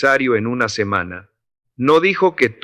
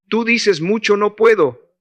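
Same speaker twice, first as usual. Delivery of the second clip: "no puedo" lifted clearly higher, pressed on "puedo"; stressed no puedo; 8 semitones higher than usual. Acted. Old Tomás mocks the young man's "no puedo", pitched high. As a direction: throw "no puedo" back at him, high and pointed.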